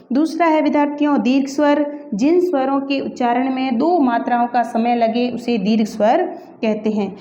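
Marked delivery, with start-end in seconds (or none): none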